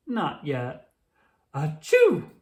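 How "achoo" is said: big loud sneeze. Acted. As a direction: sneeze it out hard. Sick pony sneezing.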